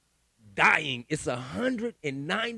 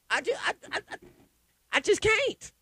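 high, strained stammer; stammer, then burst out high and exasperated; overwhelmed; 4 dB louder than usual. Real, not acted. Overwhelmed by the music situation.

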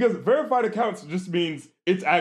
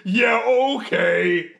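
drawn-out, raised-pitch scoff; mock sarcastic agreement; 4 semitones higher, 4 dB louder, much slower than usual. Brushing off the imagined culprit, loudly sarcastic. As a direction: stretch it out slowly in mock disbelief.

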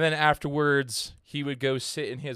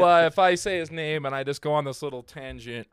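usual speaking voice

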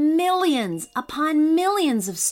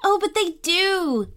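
put-on high, pleading child voice; eager childlike insisting; insistent little girl; 9 semitones higher than usual. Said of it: "insisted Poppy".

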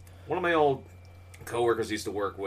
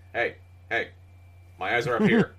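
mock offended; 2 semitones higher, 6 dB louder than usual. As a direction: scold the starers in a mock huff. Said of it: "It's like".

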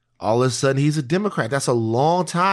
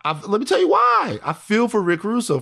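shouting over; 8 semitones higher than usual. Real, not acted. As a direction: shout to take the floor.

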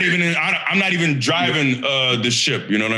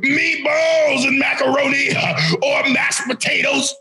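hype announcer list; 6 semitones higher, slower than usual. Acted. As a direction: pump up every dish like a ring announcer. Hype food list gas-up.

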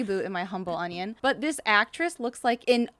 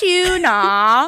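"Tuna." in a posh British way, tyoo-na, louder; British accent; 3 semitones higher, 10 dB louder than usual. Shown as a demonstration. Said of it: "the way that British chick ... says tuna".

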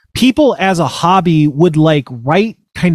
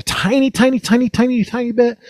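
much higher, squeezed-small repetition; tiny high voice; 9 semitones higher than usual. Real, not acted. Admitting to barely listening, in a tiny high voice. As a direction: shrink the voice upward to match the word. Exaggerating how little they listened.

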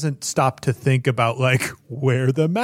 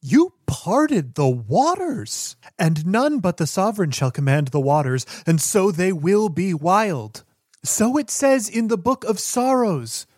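awestruck believer quoting scripture; 6 semitones higher than usual. Acted. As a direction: declaim it like holy writ. Believer proclaims miracle and quotes scripture.

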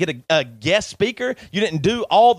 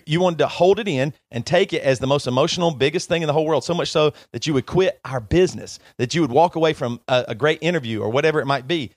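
ordinary talk, nothing marked

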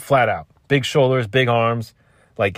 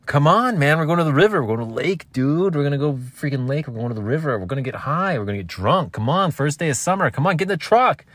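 pushy friend; 6 semitones higher than usual. Retold or imitated. Friends at the door begging him to come to the lake. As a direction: nag your friend into the truck.

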